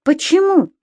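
high, astonished question; surprised. A narrator's reading. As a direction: ask it in startled surprise. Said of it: "удивился Субастик".

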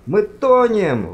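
loud, high, drawn-out cry; alarmed exclamation. A narrator's reading. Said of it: "воскликнул он". (тревожный протяжный возглас)

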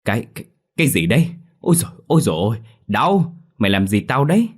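loud, panicked yelps, fast; shrieking at a friend who's hurting him; yelling in pain. Acted. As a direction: cry out as the medicine burns. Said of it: "Tí gào lên".

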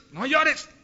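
high-pitched, exasperated father scolding; stern father. Acted. Father orders crying son to stop. (padre exasperado que regaña, voz aguda)

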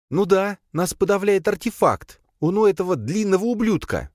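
high female character voice; irritated princess. A narrator's reading. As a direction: explain it crossly, pitched high. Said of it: "махнула принцесса". (раздражённая принцесса, высокий голос)

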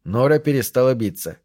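ordinary delivery, nothing marked

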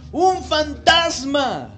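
loud, high, drawn-out cry of alarm; fearful cry. A narrator's reading. Disciples seeing him walk on the sea, fearful cry. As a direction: cry it out slowly in staged terror. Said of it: "dieron voces de miedo".

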